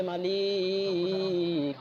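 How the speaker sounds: long drawn-out chanted plea; sustained sing-song invocation; calling out to God. Acted. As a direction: call on the Master, stretching the final vowel. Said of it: "ডাক দিয়া বলে".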